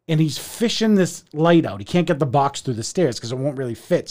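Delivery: ordinary delivery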